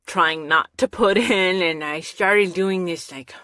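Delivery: ordinary delivery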